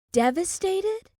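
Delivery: high, scornful echo of her word; bitter disbelief. A narrator's reading. Olivia mocking the gallery owner's grief, scornful high echo. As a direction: throw the word back in mockery. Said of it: "let out a bitter laugh".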